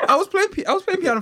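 laughing. Real, not acted. Laughs mid story.

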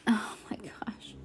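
slow breathy aside whisper. Real, not acted. Reader whispers in dismay at the upside-down book. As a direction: hush it to the listener. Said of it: "unvoiced whisper".

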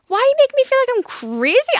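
high, loud retold outburst; outraged screech. Girlfriend reacting to ex's confession, outraged screech. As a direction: explode in high-pitched indignation.

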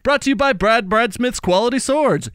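hammed-up sponsor announcer; over-the-top commercial voice; mock ad read. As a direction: sell the sword like a cheesy radio ad.

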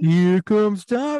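high, loud and very slow sing-song; teasing call. Retold or imitated. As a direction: drag it out loud to mock him. Teasing call while walking over.

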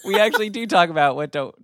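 fast, laughing through the words. Real, not acted. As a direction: giggle while correcting yourself. Laughing while correcting himself.

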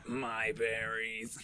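very slow robotic monotone. Retold or imitated. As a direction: drone it like a robot. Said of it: "Make fun of the robot voice".

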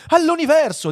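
high, loud eager cry; excited exclamation. Acted. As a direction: chime in at full excitement. Second man chimes in excitedly. (esclamazione forte e acuta)

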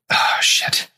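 whispered groan of pain. Real, not acted. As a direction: gasp it out in pain. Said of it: "My stomach's".

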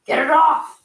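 frantic bellow, get it off me. Acted. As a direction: roar it while a lemur claws at your face. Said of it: "Zhao bellowed".

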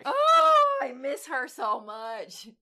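hammed-up fake cry, slow at the end; over-the-top fake grief; mock sobbing. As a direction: pretend to weep over a lost friend. Mock crying over Whitney.

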